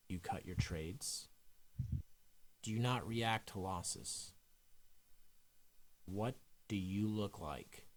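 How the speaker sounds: hushed, unhurried meditation leader; guided meditation. Acted. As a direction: guide them calmly into a visualization, leaving space between phrases. Leads a guided visualization.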